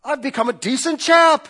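loud, high mock British accent. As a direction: play a smug British chap, high and loud. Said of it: "speak in a British accent with a sport coat".

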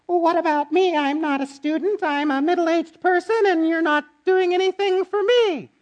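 high, whiny mock complaint; whiny churchgoer. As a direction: mimic a self-absorbed member whining. Mocking a self-centred churchgoer.

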